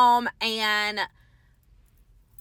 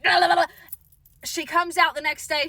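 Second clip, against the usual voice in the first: usual speaking voice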